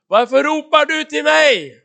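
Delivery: high, slow, booming question; shouted rebuke. Acted. God challenging the praying Moses, shouted rebuke. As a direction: shout it out word by word. Repeats God's rebuke more forcefully.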